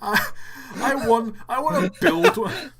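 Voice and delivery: high, loud and giddy; excited laughing outburst. Real, not acted. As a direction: blurt it out in helpless delight. Delighted, laughing at the contraption.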